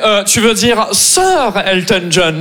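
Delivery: slow, snooty correction; slow haughty correction; condescending. Retold or imitated. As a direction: talk down to him. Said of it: "il me fait".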